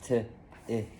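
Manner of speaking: T and ick said as separate sounds; finger spelling. Shown as a demonstration. Pupil sounding out tick for teacher, separated sounds. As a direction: break tick into T, then ick. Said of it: "Now finger spell".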